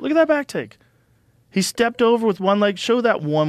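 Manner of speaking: high, loud, thrilled pointing-out; excited. Real, not acted. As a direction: gush over the move you're watching. Watching the clip, thrilled.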